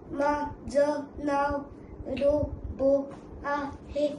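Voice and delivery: slow, word-by-word machine intro; flat steady robot, words spaced out. Acted. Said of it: "किती गोड बोलतो हा".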